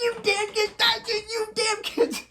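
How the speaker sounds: high, sing-song cursing Wisconsin man; mock Wisconsin driver. Wisconsin driver yelling at a kid who ran out, high mock voice. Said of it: "what any Wisconsin man would say".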